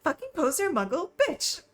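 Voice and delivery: Draco's outburst, broken by laughter; shouting through laughs. Acted. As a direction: try to yell but crack up. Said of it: "he shouted".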